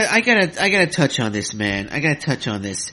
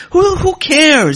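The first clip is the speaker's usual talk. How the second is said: loud, much higher, drawn-out scoff; exasperated; 8 dB louder, slower than usual. Real, not acted. Podcaster dismissing capacity questions, loud exasperated scoff. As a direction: throw it up in disbelief.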